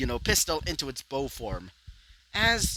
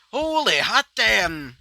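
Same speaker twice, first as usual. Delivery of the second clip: higher, louder and slow, stretched in awe; amazed exclamation; 7 semitones higher, 7 dB louder, slower than usual. Acted. Walter reacting to a bold entrance, amazed and loud. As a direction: let the awe burst out, drawn long.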